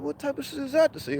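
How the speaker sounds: high, fast and soft in disbelief; mock-shocked high retort; high incredulous. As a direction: squeak it out, stunned. Incredulous retelling.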